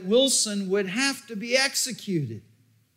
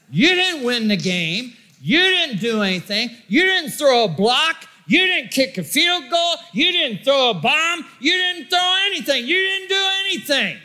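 building preaching shout; 3 semitones higher than usual. Acted. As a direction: build it up line by line like a revival preacher.

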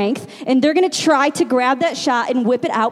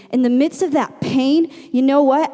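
usual speaking voice

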